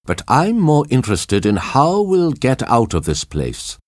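mildly impatient and self-centred; rude. A narrator's reading. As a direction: brush the injury aside for your own concern. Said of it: "said Brunt rudely".

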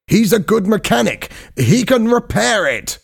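high-pitched, pressing urging; urgent pleading. A narrator's reading. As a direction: press them for rescue, voice up high. Trapped diver urges rescue.

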